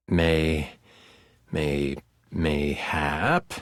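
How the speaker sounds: very low, drawn-out musing; deep, slow, questioning character voice; low-voiced game figure. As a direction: stretch it out deep and slow, lifting at the end. Acted figure in game narration.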